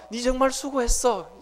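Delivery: same words in standard Seoul speech. Retold or imitated. Pastor rendering God's word for the congregation, in Seoul speech. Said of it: "이게 서울 말로 하면".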